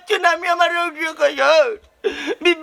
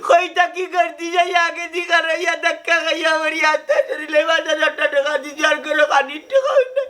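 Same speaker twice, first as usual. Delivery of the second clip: wailing lament. Acted. Injured man lamenting he cannot pay, sobbing wail.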